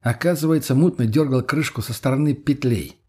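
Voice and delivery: usual speaking voice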